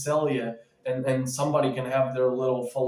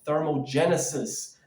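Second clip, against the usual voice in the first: ordinary talk, nothing marked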